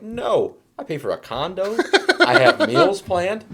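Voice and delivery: high, hammed-up outrage; comic indignation. Husband defending his plans in argument with wife, mock indignant.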